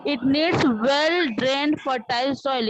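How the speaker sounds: very slow, careful reading; slow model reading. Shown as a demonstration. Teacher models correct reading very slowly. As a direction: lay out each word slowly. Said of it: "उसको ध्यान से सुनो".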